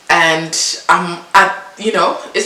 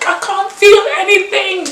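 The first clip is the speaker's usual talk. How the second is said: desperate cry; 5 dB louder than usual. Retold or imitated. Panicked in labor.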